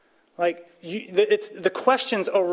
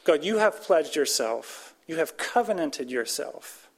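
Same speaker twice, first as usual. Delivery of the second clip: prayerful plea; 4 semitones higher than usual. Acted. Models a prayer.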